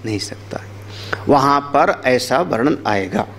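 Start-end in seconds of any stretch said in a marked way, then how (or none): 0.0-0.6 sudden hushed whisper to close the point. Real, not acted. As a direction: drop to a whisper for weight. Dramatic hushed ending of verse.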